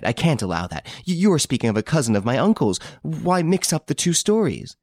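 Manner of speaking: rushed, rising protest, voice climbing high; agitated protest. A narrator's reading. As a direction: Hortense pushing back against the accusation, flustered. Hortense protesting, flustered.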